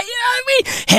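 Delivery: squealing laugh into question; laughing high. Real, not acted. Comedian laughing while checking the crowd gets it. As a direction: laugh through the words.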